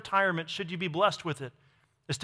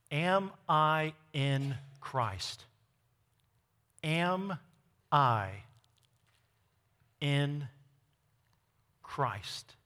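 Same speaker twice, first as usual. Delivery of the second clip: very slow, soft and low; word by word, with long pauses; solemn self-questioning; 4 semitones lower, 5 dB quieter, much slower than usual. Acted. Preacher voicing each person's question to themselves, solemn and slow.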